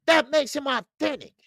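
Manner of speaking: normal talking voice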